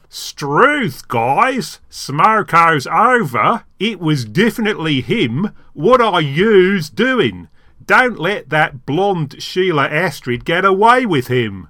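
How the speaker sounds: put-on very slow, loud, laboured Australian accent; attempted Australian accent. Actor copies the demonstrated line for the audition, exaggerated slow Australian accent. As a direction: overdo an Aussie accent, drawing out each phrase. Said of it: "Can you do it?".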